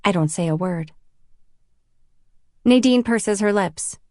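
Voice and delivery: usual speaking voice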